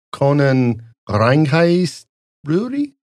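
slow, drawn-out fake German accent asking; mock German accent. Tourist asking the cab driver for the brewery, in a hammed-up German accent. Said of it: "test my German accent".

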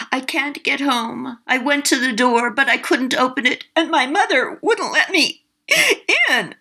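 put-on high, tearful child's voice; a lost little ghost boy pleading through tears; crying little boy. A narrator's reading.

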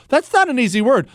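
ordinary delivery

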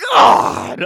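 loud, low, drawn-out groan; exasperated groan. Acted. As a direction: groan it out in mock disgust. Exasperated reaction to creepy fan post.

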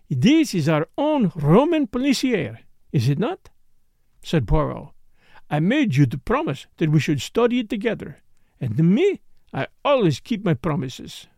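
put-on emphatic, precise French accent; courteous French-accented detective. Poirot promising Catherine a shared case, precise French accent. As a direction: declare it proudly as a French-accented sleuth.

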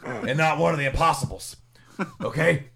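loud, fast wrestling promo rant; Dusty Rhodes rant. Retold or imitated. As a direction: hype it up like an old wrestling promo. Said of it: "your Dusty Rhodes was your best impression".

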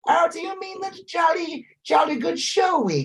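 high, plummy upper-class British; pompous sputtering colonel. Retold or imitated. Mocking British gent.

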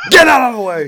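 action hero yell; shouting. Pretend. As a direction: bark it over gunfire. Mock action hero rescue.

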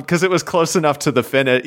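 usual speaking voice

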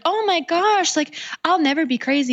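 hammed-up anxious young woman swearing she'll never be crazy; panicked girlfriend. Woman reacts to a guy calling his ex crazy, high mock panic. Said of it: "And you're like".

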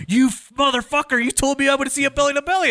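high, indignant mock rant from a furious fan; mock angry fan. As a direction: play the cheated fan who is yelling at the promoter. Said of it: "This guy was so pissed at me".

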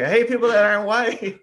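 loud, high mocking yell; mock imitation. Co-host teasing Jim about what he's been saying, loud mock imitation. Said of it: "you're just like".

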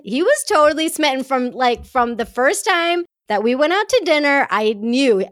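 excited. Real, not acted. Gushing to the ladies about husband.

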